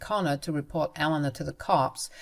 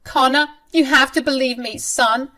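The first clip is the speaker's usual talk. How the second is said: high, louder tearful pleading; mock crying plea; 8 semitones higher, 5 dB louder than usual. Mother-in-law pleading with her son, high fake-tearful voice. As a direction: fake tears to win her son over. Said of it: "She kept crying and said".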